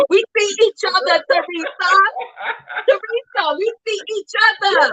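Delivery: high teasing sing-song; playful Nene quote. Pretend. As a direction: tease her with the famous line. Playfully quoting Nene to Teresa.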